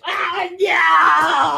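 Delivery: a loud shocked cry, then a sharp gasp; startled cry. Real, not acted. [name] coming to after the mask, screaming in shock. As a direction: jolt as if waking from a trance.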